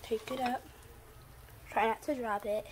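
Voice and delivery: normal talking voice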